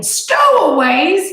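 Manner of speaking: loud, slow, high roar; captain exploding in fury. A narrator's reading. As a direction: explode at the word. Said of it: "he roared".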